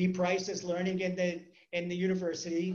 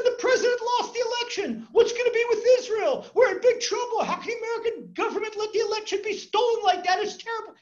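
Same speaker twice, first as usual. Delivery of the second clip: much higher, loud panicked rant; frantic yelling; 10 dB louder than usual. Retold or imitated. Worried citizen yelling to the rabbi about the election, panicked. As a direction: wail it like the sky is falling. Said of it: "He's scaring us a little bit".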